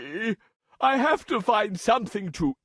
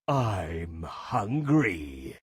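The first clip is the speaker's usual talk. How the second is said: low, slow, quiet growl-like threat; menacing lion; 4 dB quieter, much slower than usual. A narrator's reading. Lion threatens to eat the mouse, low slow menace. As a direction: rumble it low and hungry.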